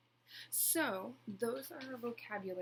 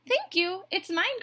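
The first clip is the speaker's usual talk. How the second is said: high, loud, proud duck voice; quacking duck; 12 semitones higher, 14 dB louder than usual. A narrator's reading. Duck claims the egg to the bluebird, quacking. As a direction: quack it out possessively. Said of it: "quacked Duck".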